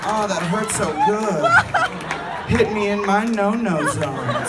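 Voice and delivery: lightly hammed-up pleading; playful kinky taunt; mock masochist. Shown as a demonstration. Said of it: "and then you go".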